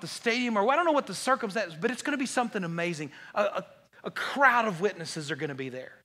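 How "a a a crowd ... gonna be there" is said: normal talking voice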